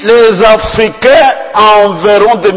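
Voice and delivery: preaching. Real, not acted. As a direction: proclaim it to the congregation. Preacher builds to emphasis. (prédication ardente)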